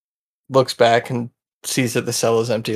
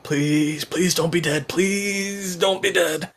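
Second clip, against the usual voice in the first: higher, drawn-out plea; pleading; 6 semitones higher, 3 dB quieter, much slower than usual. Acted. Guard begs fallen prisoner-watchers to wake, pleading. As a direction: beg softly, stretching the last please.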